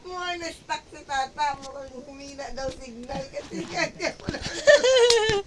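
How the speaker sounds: retelling the quote through giggles; half-laughing mock gossip; quoting while cracking up. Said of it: "Sabi niya".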